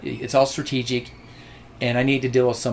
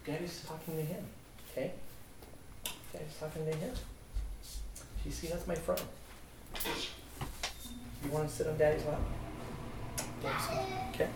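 much softer, gentle words to his little one; hushed dad aside; 3 semitones higher, 16 dB quieter, faster than usual. Real, not acted. Father soothing his child during a recording, hushed.

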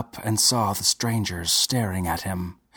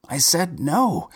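high, slow, firm no; firm refusal; 7 semitones higher than usual. A narrator's reading. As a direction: refuse flatly and firmly. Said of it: "the man answered firmly".

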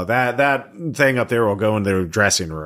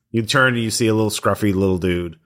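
ordinary talk

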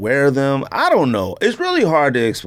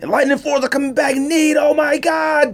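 mock hype; 12 semitones higher than usual. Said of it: "It was like".

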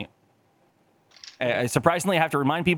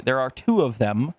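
ordinary talk, nothing marked

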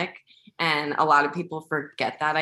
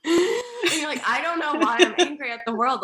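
laughing mock quote; 10 semitones higher than usual. Mock Dana quote, high, laughing.

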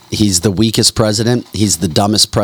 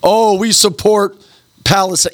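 mocking college kids; 10 semitones higher than usual. Host mimicking college kids declaring support, high mocking voice. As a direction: pitch it way up to ridicule naive students. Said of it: "dumbass college kids coming out saying".

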